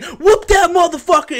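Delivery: high, loud pretend heckle; mock heckling yell. Imagining himself at Twelve Years a Slave, mock rowdy shout.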